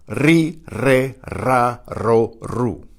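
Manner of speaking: ri, re, ra, ro, ru with rolled R; teacher's syllable drill ri, re, ra, ro, ru, each R trilled; trilled initial R. Shown as a demonstration. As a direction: roll the R at the start of ri, re, ra, ro, ru. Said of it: "The initial R is also tri-, trilled".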